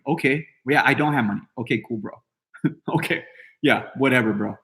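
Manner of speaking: low, soft dismissive reply; unbothered brush-off. Acted. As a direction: shrug off the critic with no interest. Acts his unbothered reply to critics.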